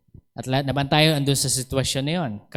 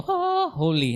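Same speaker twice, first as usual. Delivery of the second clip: mock reverent; 12 semitones higher than usual. Mocking how people see him.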